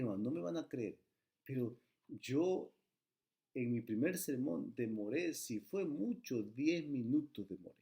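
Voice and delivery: normal talking voice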